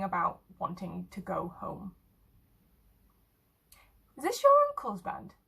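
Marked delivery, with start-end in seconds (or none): 4.2-5.3 curious. Acted. Morgan asks, high and loud.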